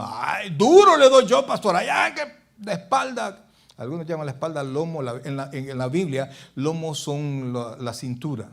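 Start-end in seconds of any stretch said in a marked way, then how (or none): 0.0-3.5 voz burlona exagerada, más aguda y fuerte; joven bromista. Retold or imitated. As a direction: se burla del joven que se limpia la espalda. (caricatura de joven presumido)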